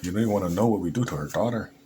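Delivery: a hammed-up Mexican accent, fast; a coyote threatening in a bad Mexican accent; light mock Mexican accent. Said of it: "that's my worst Mexican accent".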